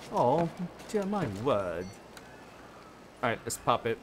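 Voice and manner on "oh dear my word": a light mock British gasp; a hint of a prim British lady, oh dear; lightly put-on British accent, my word. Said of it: "Sorry, I love accents".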